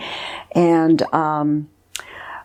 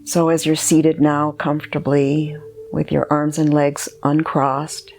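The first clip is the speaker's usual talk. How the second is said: guided meditation. Acted. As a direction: guide the listener gently inward, leaving space between phrases.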